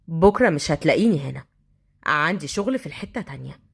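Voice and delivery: low-pitched offhand announcement; brooding street thug. Acted. Thug telling girl he leaves, low guarded voice. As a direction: let it drop low and sink away.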